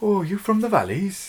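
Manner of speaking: put-on high, lilting Welsh accent. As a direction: ask it in a sing-song Welsh accent. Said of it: "you all know what Welsh accents sound like".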